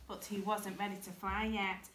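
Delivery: ordinary delivery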